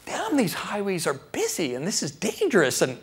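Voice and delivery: high, agitated grumbling rant; worked-up, exasperated venting; angry griping. Retold or imitated. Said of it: "I'm angry".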